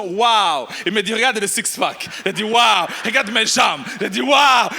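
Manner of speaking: normal talking voice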